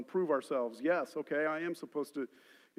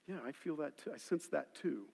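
soft, low, inward agreement; quiet recognition; 3 semitones lower, 11 dB quieter than usual. Acted. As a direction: say it gently, as if sensing it yourself. Acts out receiving a word.

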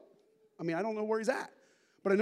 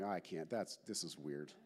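muttered aside; 13 semitones lower, 10 dB quieter than usual. Retold or imitated. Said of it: "I'm like".

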